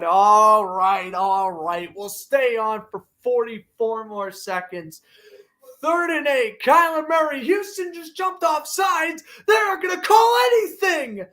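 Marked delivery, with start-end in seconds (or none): 5.8-11.3 rising, high-pitched outraged shouts; excited shouting. Real, not acted. Fan commentator erupts at missed offside.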